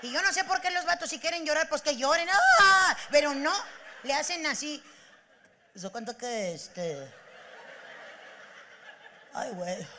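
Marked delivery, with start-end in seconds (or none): 2.3-3.0 sudden loud mock sob; mock wail. Mocking men who hold back their tears, sudden loud sob. 5.8-9.9 a hint of a lower choked-up man; lightly voiced grieving guy; teary Rigoberto. Imitating Rigoberto mourning his wrecked car, lower and choked.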